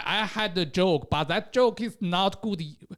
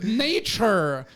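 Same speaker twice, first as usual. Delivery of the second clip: ordinary talk, nothing marked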